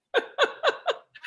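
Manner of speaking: high burst of laughter; delighted high giggle; laughing. Real, not acted. Host greeting her friend on video, laughing.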